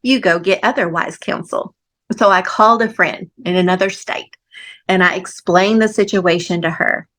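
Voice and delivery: normal talking voice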